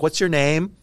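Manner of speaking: a hint of the girlfriend's voice relaying the question; girlfriend asking. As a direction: toss the question back through the door. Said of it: "She's like".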